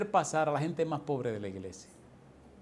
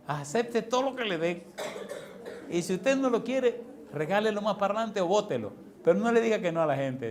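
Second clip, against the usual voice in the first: higher-pitched, emphatic urging; imitated insistent advice; 6 semitones higher than usual. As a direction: press the advice home like a well-meaning church brother. Brother urging pastor, retold.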